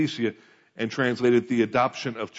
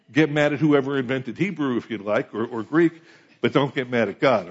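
usual speaking voice

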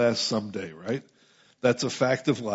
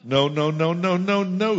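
emphatic denial; 6 semitones higher, 5 dB louder, slower than usual. Real, not acted. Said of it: "He doesn't say that".

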